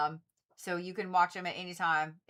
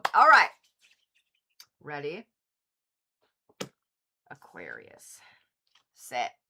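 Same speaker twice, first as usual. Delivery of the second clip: normal talking voice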